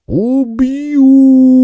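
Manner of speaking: high, loud ghostly howl; howling spirit. Acted. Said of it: "взвыл неупокоенный".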